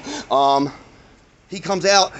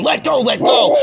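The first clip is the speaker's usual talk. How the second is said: higher, urgent mock yell; imitated yelling; 9 semitones higher than usual. Acts out man punching the dog.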